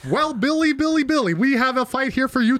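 high, bright broadcast patter; ringside announcer. Acted. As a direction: throw to your co-host on air.

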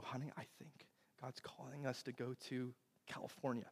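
very soft, careful voice; quiet retelling of an intimate moment; hushed, tentative confiding. Retold or imitated. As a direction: break it to your wife gently. Gently breaking news to wife.

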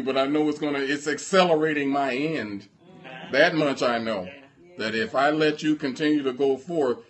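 lightly acted adversary, forceful and insistent; the enemy's threat. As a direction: press the threat home at preaching volume. Voices the enemy threatening a believer.

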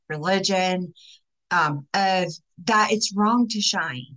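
ordinary delivery

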